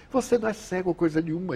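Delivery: high, fast indignant retort; retold accusation. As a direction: imitate an irritated man's contradiction. Blind man angrily accuses the speaker.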